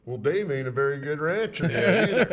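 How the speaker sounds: laughing through the line, loud; can barely get it out for laughing. Retold or imitated. Retelling the punchline, laughing.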